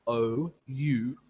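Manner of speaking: usual speaking voice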